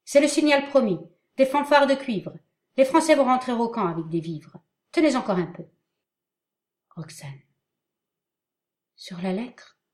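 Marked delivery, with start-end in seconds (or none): 0.1-5.7 high, loud thundering call. Acted. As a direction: boom it across the field. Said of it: "d'une voix tonnante".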